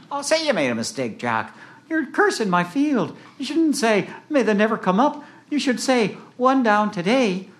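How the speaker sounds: high-pitched indignant farmer; scolding farmer. Acted. Farmer scolding Jack for cursing his field, high indignant voice. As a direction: correct him in a high, cross farmer's voice.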